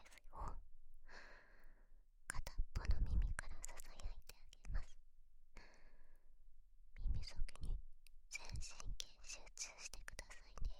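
soft intimate whisper in one ear. Pretend. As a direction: lean in and breathe the words.

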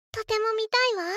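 eager excitement. Acted. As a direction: beg to see it, thrilled. Eager to watch the dance.

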